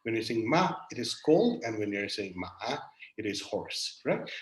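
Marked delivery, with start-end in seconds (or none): 0.5-0.7 "ma" falling in pitch. Shown as a demonstration. Lecturer recapping Mandarin tones on a graph, "ma" in falling tone. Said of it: "this is the falling tone". 2.4-2.8 "ma" dipping, then rising; falling-rising tone. Shown as a demonstration. Lecturer recapping Mandarin tones on a graph, "ma" in falling-rising tone. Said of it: "this is the fall and the rise tone".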